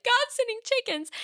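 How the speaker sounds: high, strained crying voice while reading; imitating herself bursting into tears; bawling. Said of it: "and I started bawling".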